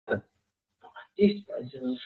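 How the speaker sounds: slow whisper of "No canchispa"; hushed slow aside. Acted. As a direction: breathe "No canchispa" slowly. Quiet aside after example.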